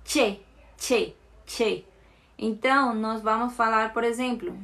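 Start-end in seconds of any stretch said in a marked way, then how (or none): 0.0-1.8 che with a firm TCH sound; strong CH. Shown as a demonstration. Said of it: "som de T C H".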